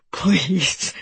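understated desperate begging; acted frightened plea; crying plea. A narrator's reading. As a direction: beg with a touch of panic. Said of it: "he cried".

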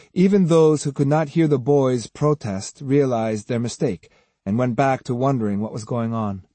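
ordinary delivery, nothing marked

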